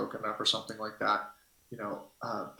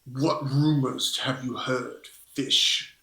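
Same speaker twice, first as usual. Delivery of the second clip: suspicious Bamri; 4 dB louder, much slower than usual. Acted. Bamri leaning in to question the fish-folk visitor, slow and suspicious. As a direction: size up the stranger, drawing it out. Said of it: "Bamri kinda perks up and leans in".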